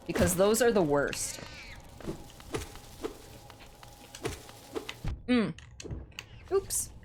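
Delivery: talking while chewing candy; crunching candy between words. Real, not acted. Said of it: "I'm sorry if I'm crunching in your ear".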